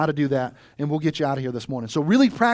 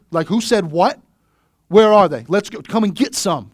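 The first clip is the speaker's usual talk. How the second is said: mock fired up; 3 semitones higher, 8 dB louder than usual. Acting out being riled up.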